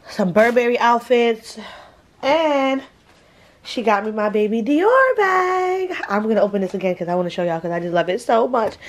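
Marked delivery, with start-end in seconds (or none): 2.2-5.9 excited. Real, not acted. Gushing over favorite gift.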